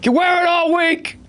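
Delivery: high-pitched bellow at the guy; furious yelling boss. Retold or imitated. Boss screaming at Carpenter, calling him a liability, high furious bellow. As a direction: scream it like a boss firing someone.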